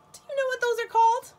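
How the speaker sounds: high, loud, fast quiz question; excited question. Acted. Teacher quizzing children about sea creatures, excited. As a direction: ask it bright and eager, fast.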